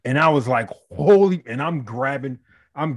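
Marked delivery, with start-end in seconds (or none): 0.9-1.4 loud, high burst of shock; shocked exclamation. Retold or imitated. Owner watching his dog latch on, loud shocked blurt. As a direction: blurt it out, stunned.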